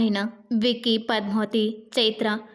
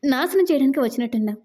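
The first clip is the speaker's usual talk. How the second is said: ordinary delivery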